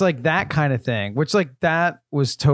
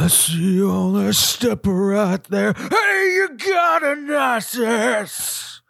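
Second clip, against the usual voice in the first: hammed-up rock singer voice, slow and much higher; 9 semitones higher, much slower than usual. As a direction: stretch the last words into a high, drawn-out holler. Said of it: "said it in a [name] voice".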